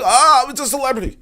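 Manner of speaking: high-pitched imitation of a gushing fan; mock starstruck gushing.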